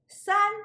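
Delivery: San held on a high level tone; first tone. Shown as a demonstration. Teacher showing students San in the first tone. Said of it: "pronounced in the first tone".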